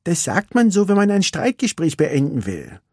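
sulky little racer; Vanellope. A narrator's reading.

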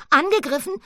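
high, loud alarmed "Angegriffen"; alarmed cry. Acted. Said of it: "rief Paula". (erschrocken, hoch und laut gerufen)

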